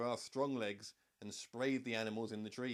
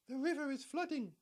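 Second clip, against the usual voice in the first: very high, squeaky and a bit louder; squeaky giraffe; 3 dB louder than usual. A narrator's reading. Giraffe warning the animals, high and squeaky. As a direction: squeak it out like an alarmed little giraffe. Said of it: "in a surprisingly squeaky voice".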